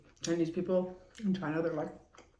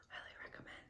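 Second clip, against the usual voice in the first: whispered again; 27 dB quieter than usual. Real, not acted. To viewers, whispering the recommendation. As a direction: hush highly recommend. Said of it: "I can't even hear you".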